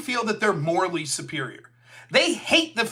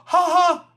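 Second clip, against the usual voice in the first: mock laugh; 9 semitones higher, 7 dB louder than usual. Mock laugh after parody.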